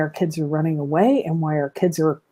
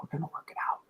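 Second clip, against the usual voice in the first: whisper; 3 semitones lower, 18 dB quieter, much faster than usual. Shown as a demonstration. Reassuring child, fading out.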